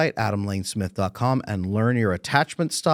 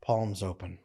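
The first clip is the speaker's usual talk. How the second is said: a soft sigh, then much softer and loosened; relaxed release; 3 semitones lower, 8 dB quieter than usual. Shown as a demonstration. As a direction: let it all go with an audible sigh. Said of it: "Tight, no".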